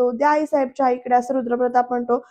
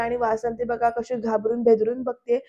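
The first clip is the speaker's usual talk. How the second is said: ordinary talk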